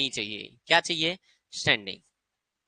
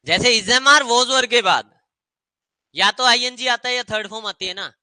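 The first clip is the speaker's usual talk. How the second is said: loud drill; 6 semitones higher, 5 dB louder than usual. Shown as a demonstration. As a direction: hammer the rule home for the class. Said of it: "teacher drilling rule loudly".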